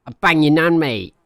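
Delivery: loud, high mock London lad jab; hammed-up cheeky Brit taunt; British insult banter. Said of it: "Stuff like that shit".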